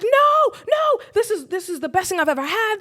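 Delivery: high, loud pleading cry of refusal; a girl refusing to give up her pearls, crying out high and loud; protesting shout. Retold or imitated. Girl refusing to hand over pearls, high loud protest. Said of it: "she starts fighting".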